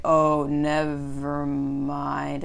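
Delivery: very slow, stretched-out retort; drawn-out backing off. Retold or imitated. Runner answering people who doubt him, mock drawn-out slow. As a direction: drag out every syllable of the mock reply. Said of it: "It's like".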